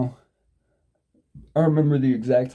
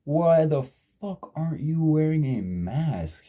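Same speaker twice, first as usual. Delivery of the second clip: higher, slow mock-angry rant; replaying the fury, drawn out and pitched up; retold cussing out; 6 semitones higher, slower than usual. To the maskless secretary, a higher, slowed, mock-angry cussing out. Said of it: "I'm like".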